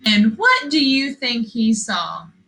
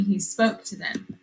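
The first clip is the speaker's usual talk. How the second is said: usual speaking voice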